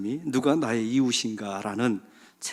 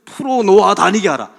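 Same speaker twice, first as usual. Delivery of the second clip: loud, commanding proclamation; proclaimed command; 6 semitones higher, 11 dB louder than usual. Acted. Preacher booms Jesus' command to the church. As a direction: ring out Jesus' command to the crowd.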